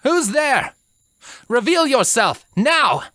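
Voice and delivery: high, firm demand; commanding heroine. A narrator's reading. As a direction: order the hidden thing to show itself. Twilight demands the voice appear.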